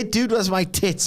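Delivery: slow mock Australian drawl; Australian accent. Shown as a demonstration. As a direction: do it like a lazy Aussie bloke. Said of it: "in an Australian accent".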